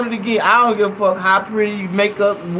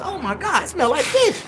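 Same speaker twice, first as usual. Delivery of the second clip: high, breathy shock at the fish smell; disgusted gasp; 9 semitones higher than usual. Retold or imitated. Comic to the audience, shocked at the fish smell. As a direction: recoil at the stink, pitched way up. Disgusted realization at church door.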